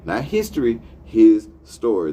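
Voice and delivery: pry "his" off "story" to make the pun land; stress on his story. Shown as a demonstration. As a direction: punch "his" loud in "his story". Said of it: "this is what we know as his story".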